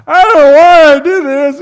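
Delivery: loud, high-pitched mock wail; hammed-up tearful outburst, loud and high; mock anguished wail. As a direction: cry it out like a man in over-the-top sorrow. Acting out backslider's sorrowful cry.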